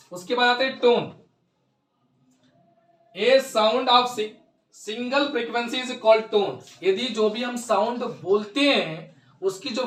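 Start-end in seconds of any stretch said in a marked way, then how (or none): 3.1-6.6 very slow, word by word, for students to write; slow dictation. Shown as a demonstration. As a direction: spell out the definition slowly so the class can note it. (धीमी गति से लिखवाना)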